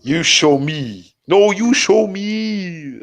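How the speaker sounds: mock Peter, stretching each word; imitating Peter. Friend riffing on Peter's catchphrase, slow mock voice. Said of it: "I'm gonna make a bit out of that".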